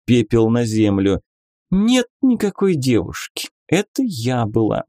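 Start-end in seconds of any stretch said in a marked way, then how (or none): 1.7-4.8 higher, teasing lady; lady inquisitor voice. Acted. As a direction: play a coy, mocking lady. Beatrisa, playful inquisitor lady. (игривая дама-инквизитор)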